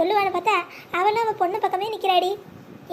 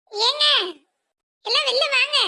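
high carrying call to the household; wife calls the family out of the house; 6 semitones higher than usual. Acted. Calling everyone outside.

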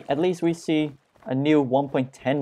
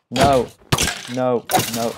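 loud protest; 5 dB louder than usual. Real, not acted. Protesting his in-game death.

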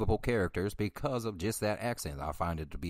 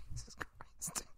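laughing whisper; 10 dB quieter, slower than usual. Real, not acted. Laughing at himself on video.

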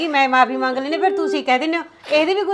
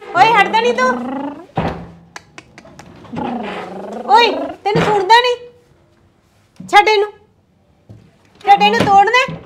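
sharp, raised scolding at a pestering brother; angry scolding; 5 semitones higher than usual. Acted. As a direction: yell at him to stop. Sister scolds brother breaking gate. (ਗੁੱਸੇ ਨਾਲ ਉੱਚੀ ਝਿੜਕ)

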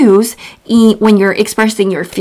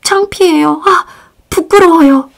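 embarrassed demo; 6 semitones higher, much faster than usual. Shown as a demonstration. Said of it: "And then you can say".

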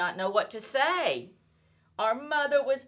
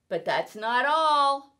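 boastful; 7 semitones higher, 5 dB louder than usual. Acted. Cat boasting, building suspense.

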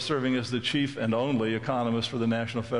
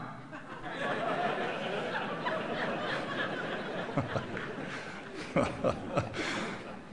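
audience laughter; 7 dB quieter than usual. Real, not acted.

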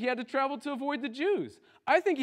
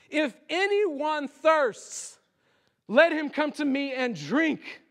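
crying out; 6 semitones higher, 3 dB louder, slower than usual. Acted. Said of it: "hear the words of your Savior cry out".

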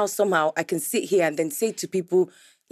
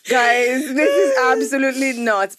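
laughing protest; 4 semitones higher, 4 dB louder, slower than usual. Real, not acted.

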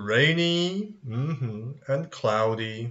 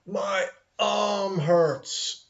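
slow, high mock complaint; hammed-up, stretched cry of pain; hurting patient; 7 semitones higher, much slower than usual. Acts a patient with arm pain.

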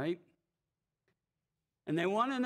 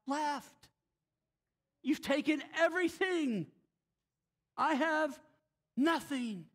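rising, pleading cry of loss, much higher; Micah's lament; 4 semitones higher than usual. Retold or imitated. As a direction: plead it like a man robbed of everything. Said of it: "what he says in verse twenty-four".

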